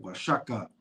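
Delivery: "Oaxaca" softly as wa-SHA-ka; Nahua pronunciation. Shown as a demonstration. Confirming it for a co-host, "Oaxaca" softly as wa-SHA-ka. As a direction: quietly say "Oaxaca" with sh for the x. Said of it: "how you pronounce it in Nahua".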